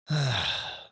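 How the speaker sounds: one long exhaled sigh; audible sigh. Acted. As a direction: let out a high sigh. Boss pauses before changing the subject.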